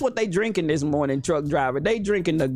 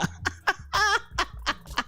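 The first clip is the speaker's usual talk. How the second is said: high laugh; 13 semitones higher, 5 dB quieter than usual. Real, not acted. Laughing at own joke.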